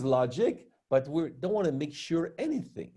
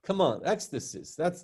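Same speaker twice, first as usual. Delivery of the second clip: ordinary delivery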